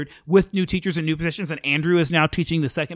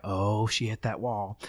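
quiet realization; 6 semitones lower, 8 dB quieter, slower than usual. Retold or imitated. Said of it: "And I was like".

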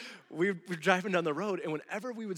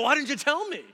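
much higher, louder accusing shout; angry demand; 11 semitones higher, 11 dB louder than usual. Retold or imitated. Husband snapping at wife after a near miss, angry. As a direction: blow up at her. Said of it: "I'm like".

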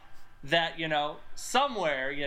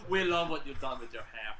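lightly German-accented mock compliment, lower; 6 semitones lower, 3 dB quieter than usual. Podcaster quoting a film character to friends, slight German accent. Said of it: "I've given him a German accent".

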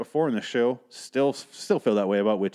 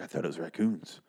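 husband quote, low and quiet; 4 semitones lower, 7 dB quieter than usual. Retold or imitated. Quoting husband about the trash can.

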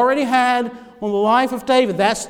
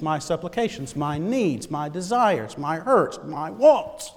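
normal talking voice

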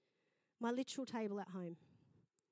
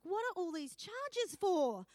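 high, loud, drawn-out complaint; hammed-up indignant question; exasperated husband; 8 semitones higher, 7 dB louder, slower than usual. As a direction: imitate a husband protesting the bills. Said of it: "her husband was like".

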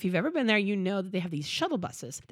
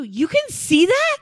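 high, slow, amazed question; mock astonished parent; stunned disbelief; 10 semitones higher, 9 dB louder, slower than usual. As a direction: ask it like you can't believe your ears. Family stunned by daughter's remark.

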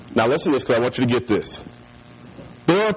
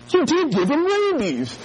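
high, slow mock horror; mock accusation; 12 semitones higher than usual. Brother blaming little Anton, mock horror. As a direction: draw out the accusation, pitched high.

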